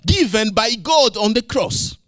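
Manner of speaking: fervent sermon delivery; preaching. Real, not acted. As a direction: preach it out to the church. Preaching at full force.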